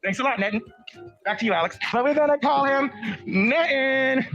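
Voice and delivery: normal talking voice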